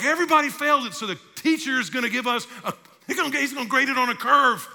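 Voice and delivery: high, loud, hopeful student chatter; excited failing students. Acted. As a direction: blurt it out as a relieved classmate.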